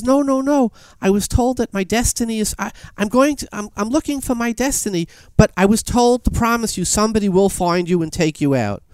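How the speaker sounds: high, insistent protest; hurried refusal. Retold or imitated. Young man refuses the princess in the fable, insistent.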